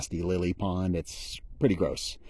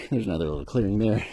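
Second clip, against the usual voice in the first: usual speaking voice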